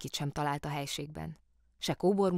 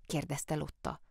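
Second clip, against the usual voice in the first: usual speaking voice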